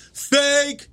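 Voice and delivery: high, drawn-out heckle; heckler's call. Retold or imitated. Heckler in the front to comic, high jeering call. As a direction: call it out from the crowd.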